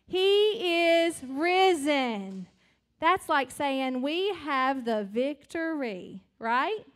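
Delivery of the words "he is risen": slow, drawn-out and louder "He is risen"; slow proclaiming. Shown as a demonstration. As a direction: stretch out "He is risen" as a joyful declaration. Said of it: "Can you say that with me?".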